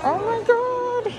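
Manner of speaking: high, drawn-out oh-my-God; real awestruck squeal; amazed. Real, not acted. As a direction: gasp it out high in awe. First sight of the beautiful resort.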